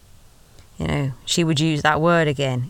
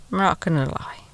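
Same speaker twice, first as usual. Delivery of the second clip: breathy secret-sharing whisper to the listener; hushed whisper; 4 dB quieter than usual. Pretend. Telling the listener about the cinema date, in a whisper. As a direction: whisper it, as if still sitting in the dark cinema.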